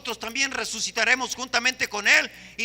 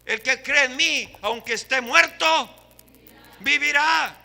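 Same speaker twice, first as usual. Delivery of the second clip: louder ringing proclamation of the verse; proclaimed scripture; 2 semitones higher, 6 dB louder than usual. Real, not acted. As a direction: declaim the promise to the room. Preacher quoting scripture loudly.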